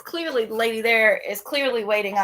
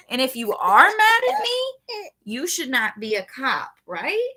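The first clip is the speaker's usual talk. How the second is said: indignant retort; 6 semitones higher, 3 dB louder than usual. Retold or imitated. Telling off cop in retelling.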